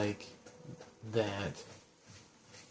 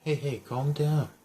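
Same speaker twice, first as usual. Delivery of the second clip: raised voice, pitched up to cut through panic; urging calm; 4 semitones higher, 7 dB louder than usual. Acted. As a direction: loudly settle down a frightened kid. Reading player's reply to frantic child.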